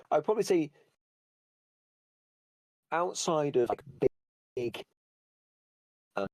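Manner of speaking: choppy, broken-up internet audio; words cut up by a bad connection; lagging audio. Real, not acted. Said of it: "I think you're lagging".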